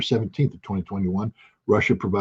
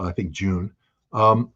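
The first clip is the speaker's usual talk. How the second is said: ordinary delivery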